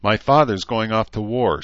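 usual speaking voice

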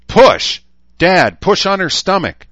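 loud, high commanding shout; shouted orders. A narrator's reading. Rachel shouting orders to her father, loud and high. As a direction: bark the orders urgently. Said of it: "Rachel shouted".

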